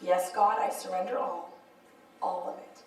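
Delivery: low, hushed prayer, fading away; humble surrender. Acted. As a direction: yield softly, with pauses.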